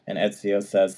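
ordinary talk

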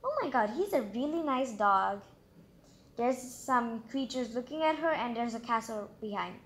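plain reading voice

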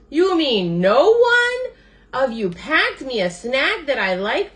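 high, drawn-out loud wail of disbelief; calling out. A narrator's reading. Starving Bird calls to his friends, high outraged wail. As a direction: cry out to everyone, stretching the opening words. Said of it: "called to his friends".

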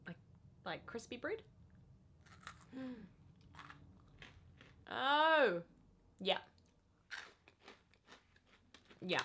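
talking between bites; mouth full, chewing. Real, not acted. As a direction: lips pressed while chewing. Seen 2: Bites shown before; chewing lips and fingers at mouth after.

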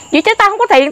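high, sharp retort; angry retold comeback. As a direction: snap back high that you have no money. Retold shouting match with thug.

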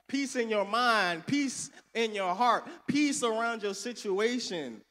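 rolling sermon build; preaching. Acted. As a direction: lift the church with it. Preaching at full force.

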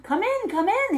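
loud, high and hearty welcome; big cheerful voice. A narrator's reading. Victor welcoming the soaked travellers in, big and cheerful. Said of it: "he said in a big, cheerful voice".

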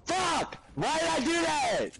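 sudden high furious yell; real frustration bursting out; angry outburst. Real, not acted. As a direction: blurt it out in a rage. Angry outburst after a mistake.

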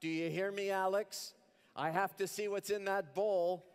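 high mother calling through a door. Acted. Mother calling to son through the bathroom door, high. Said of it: "my mother begins to call".

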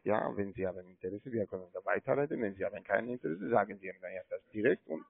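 fast, pressing pitch; hurried. Real, not acted. Caller pressures Herr Wurstwasser, says he has no time, fast. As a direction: rattle it off fast to push for a decision.

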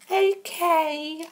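put-on high-pitched girly falsetto. Contestant [name] answering the host, girly voice. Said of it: "why did you do that girly voice".

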